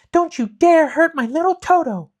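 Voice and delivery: high, loud furious shout; Dorothy shouting at the lion to save Toto. A narrator's reading. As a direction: yell to protect the little dog. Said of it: "she shouted at the lion".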